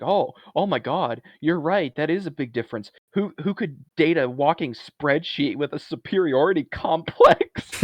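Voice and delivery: high, loud sarcastic realisation; exaggerated concession building to a laughing jab; mock sarcasm. Alice tearing into Jordan over a big difference, mock sarcasm.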